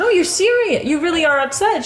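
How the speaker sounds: high, startled "you're serious?"; suddenly realizing she means it; surprised disbelief. Retold or imitated. To his crying wife, high startled surprise.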